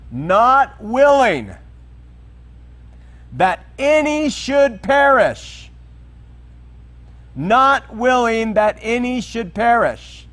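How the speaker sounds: "not willing that any should perish", slow, high and emphatic; emphatic scripture quote. Real, not acted. Emphasizing the key verse while preaching.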